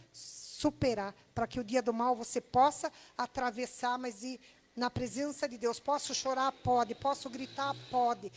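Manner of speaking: loud, rising call-and-response preaching; fiery preaching. Acted. Preacher rousing the church to cry and shout before God. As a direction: preach it out to the congregation.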